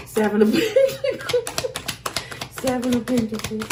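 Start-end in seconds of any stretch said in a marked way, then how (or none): 0.1-1.7 name the card through a laugh, pitched up. Real, not acted. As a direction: laugh as you say "Seven of Pentacles". Laughing while naming a card.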